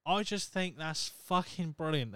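ordinary talk, nothing marked

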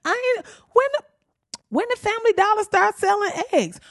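mock disbelief. Podcast host mocking shoppers finding dollar-store eggs, high mock disbelief. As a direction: squeal it like a shocked shopper.

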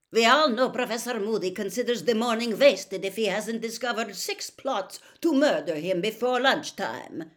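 high, forceful sarcasm; loud scorn. A narrator's reading. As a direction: announce it loudly for everyone to hear. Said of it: "said Karkaroff loudly".

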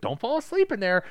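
high, lilting mother's call; mock nagging mom. Film mother calling to daughter in the tub, mock nagging.

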